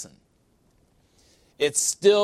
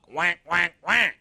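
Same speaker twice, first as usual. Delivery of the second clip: duck quacks; 3 semitones higher, slower than usual. Retold or imitated. The world mocking the believer, quacking. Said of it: "talk like a duck".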